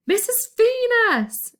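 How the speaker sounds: high, loud, slow shout. A narrator's reading. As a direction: bellow to wake a sleeper. Everyone shouting to wake her.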